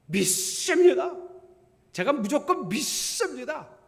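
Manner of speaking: loud, high, drawn-out profession of belief; mock-fervent shout, stretched slow; zealous declaration. Imitates blindly zealous believers.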